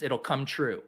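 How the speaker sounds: ordinary delivery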